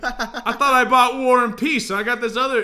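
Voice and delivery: high, loud, laughing complaint; annoyed customer. Retold or imitated. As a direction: whine as a cheated buyer. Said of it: "When they're like".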